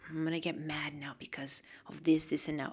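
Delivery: low, hushed, quick muttering; mock deliberation. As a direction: mutter it softly like a mock plan. Voices choosing anger as a plan.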